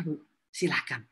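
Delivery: ordinary talk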